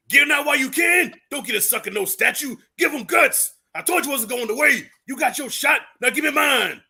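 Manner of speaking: high, loud challenger's taunt; Clubber Lang. Acted. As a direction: shout it like a boxer calling out his rival. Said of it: "the line from, uh, Rocky three with Clubber Lang".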